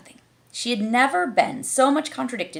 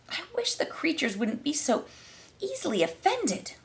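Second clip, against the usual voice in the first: put-on much higher exasperated girl; Alice's voice; 8 semitones higher, 4 dB quieter than usual. A narrator's reading. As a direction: sigh it to yourself. Alice thinks to herself.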